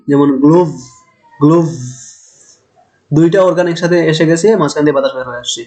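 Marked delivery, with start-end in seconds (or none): 0.4-1.7 গ্লোভ ending in a buzzing v; demo v sound. Shown as a demonstration. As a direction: hold the final v of গ্লোভ with friction. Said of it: "যদি ভি ধরি". (গ্লোভ শব্দের শেষে ভ ঘষে বলা)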